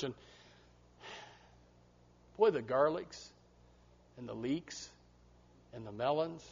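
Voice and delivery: soft, dreamy, wistful mock longing for the world; longing churchgoer. Churchgoer leaving church, soft wistful longing for worldly fun. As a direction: sigh over the neighbors' fun, half-tempted. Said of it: "when you leave church and you say".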